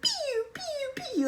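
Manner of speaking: high mock laser-gun pews from the robot guy. Imitating the robot guy in Grandma's Boy, high laser pews. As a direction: squeak out toy laser shots. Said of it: "the robot guy who's like".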